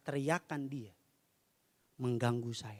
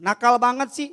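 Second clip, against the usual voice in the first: mock scolding, higher and louder; a sudden raised exclamation of exasperation; exasperated scolding; 10 semitones higher, 13 dB louder than usual. Wife tempted to call the active child naughty, exasperated scolding.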